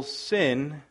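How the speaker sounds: ordinary talk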